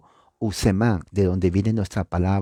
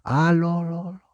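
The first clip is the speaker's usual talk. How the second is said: fading echo; 8 semitones higher than usual. Shown as a demonstration. Preacher imitates the valley echo returning, fading to a whisper. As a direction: echo dying away to a whisper. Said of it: "que retumba con usted para atrás".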